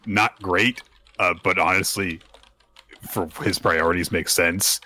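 ordinary talk, nothing marked

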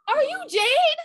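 squealed high and loud, mock excited fan; high-pitched fan recognizing a celebrity; starstruck fan. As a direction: gush like a fan who just spotted a star. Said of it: "The fan turns and goes".

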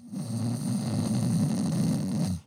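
long, low rumbling snore; imitated snoring. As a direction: snore like a sleeper out cold. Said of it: "That's pretty good impression".

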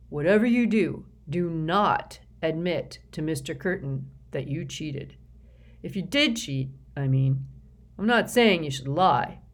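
loud, self-important official; pompous executive voice. A narrator's reading. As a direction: lecture the kid with puffed-up importance. S Q character voice.

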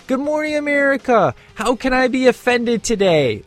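high, sing-song sarcastic greeting; mock-cheerful meme voice. Vlogger mocks easily offended people, high mock-cheerful. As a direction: chirp it like a smug morning host, mocking. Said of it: "the meme that I love is".

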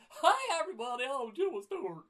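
loud, very high cartoon greeting; hammed-up cartoon version of the actor; Jimmy Stewart. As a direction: squawk it like a cartoon character. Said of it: "That was like the cartoon version".

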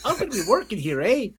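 high mock Canadian with eh; lightly voiced Canadian. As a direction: tease the newcomer's accent. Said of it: "in my country and goes".

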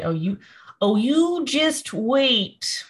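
put-on high, slow old-timer voice; old prospector. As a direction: warble it like a cranky old man. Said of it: "and then they say".

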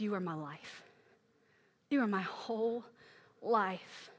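low, hushed devotion; quiet, heartfelt prayer; tender surrender. Retold or imitated. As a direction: confess it softly, with pauses. Tender confession to God after anguish.

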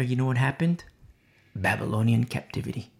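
normal talking voice

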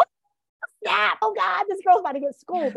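put-on gasp, then gloat like the girl's about to get owned; gleeful gasp. Gleeful reaction to rich dad story.

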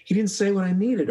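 replaying a maddening interview; mock exasperation at a subject who won't say it; frustrated outburst. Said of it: "I'm usually frustrated".

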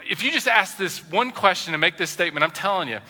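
ordinary talk

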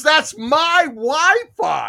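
loud, high, very drawn-out protest; retold exasperated outburst. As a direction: act out an owner at the end of his patience. Acting out owner's exasperated repeat.

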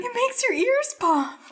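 squeaky high cartoon sidekick; Pinky. Host quotes Pinky poking his belly button, goofy high voice. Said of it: "pretty good Pinky impression".

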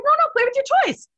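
dead serious. Retold or imitated. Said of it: "I was like".

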